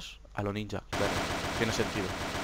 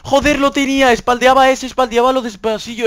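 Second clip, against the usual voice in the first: high, loud, furious complaint; outraged; 11 semitones higher, 10 dB louder than usual. Real, not acted. Gamer at the game, furious high protest.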